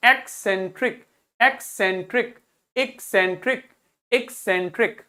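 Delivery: एक्सेंट्रिक repeated in separated syllables, rising at the end; pronunciation drill. Shown as a demonstration. Teacher drilling pupils on the written word, measured syllabic एक्सेंट्रिक. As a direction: chant out एक्सेंट्रिक evenly for learners. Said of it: "इसका pronunciation पढ़िए".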